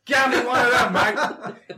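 put-on Australian accent. Redoing his line to the shop guy on request, mock Australian accent. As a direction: play up an Australian accent through laughter. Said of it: "In your best Australian accent".

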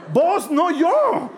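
high, loud, drawn-out disclaimer; exaggerated high-pitched retort; comic protest. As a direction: shout the blame away in mock panic. Comically shifts blame onto Jesus.

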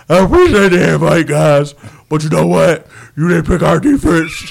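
loud, low booming put-on voice; hammed-up big athlete impression; Calais Campbell. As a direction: boom it out, low and heavy. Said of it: "that's my Calais Campbell voice".

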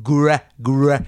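"Gra-, gra-" broken into slow syllables; "Gra-" repeated like a child reading; sounding out words. Shown as a demonstration. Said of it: "sound out the words".

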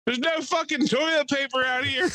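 high yelled complaint from the woods; distant yell. Retold or imitated. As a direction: call out stranded and annoyed. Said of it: "and he goes".

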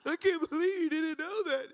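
high, loud, hammed-up wail; exaggerated tearful whine; mock crying. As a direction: pretend to burst into tears in disbelief. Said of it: "and then you're crying".

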